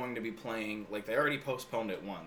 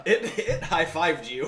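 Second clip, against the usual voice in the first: slow, laughing loud delight; 7 semitones higher, 10 dB louder, slower than usual. Real, not acted. Friend laughing at a firefly landing in a palm. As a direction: crack up as you say it.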